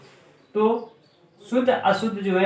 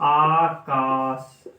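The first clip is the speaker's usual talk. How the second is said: each syllable drawn out slowly for the class; slow dictation; 3 semitones lower, much slower than usual. Shown as a demonstration. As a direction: spell it out slowly, like dictating to children. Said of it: "आकाश का शुद्ध रूप क्या है".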